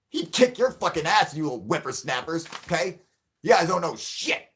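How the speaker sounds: put-on play-scold the chat like a grumpy old man; mock fury at the whippersnappers; mock angry rant. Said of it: "Not in the fucking mood".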